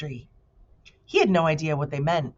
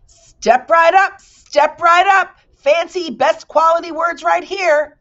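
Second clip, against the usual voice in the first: high, loud, slow barker call; loud carnival pitchman; booming salesman; 11 semitones higher, 11 dB louder, slower than usual. Acted. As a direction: draw the shoppers in like a fairground barker. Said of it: "announced one man in a booming voice".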